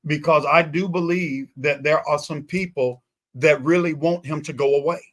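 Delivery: ordinary talk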